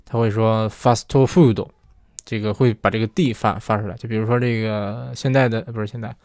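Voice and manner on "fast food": fast food said slowly as fasu tofuto, in a mock Japanese accent; slow Japanese-accented English, with a vowel added after each final consonant.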